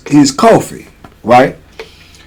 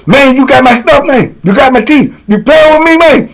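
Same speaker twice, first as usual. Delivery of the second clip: high-pitched mock-outraged challenge; angry accusation; 8 semitones higher than usual. Inmate accusing old head of taking teeth, mock-angry. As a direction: imitate a toothless man accusing his rival.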